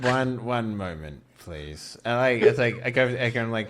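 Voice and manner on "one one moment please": soft, slow, stalling; buy time quietly and slowly; hushed stalling. Acted. Ace stalling Edgar quietly, soft and slow.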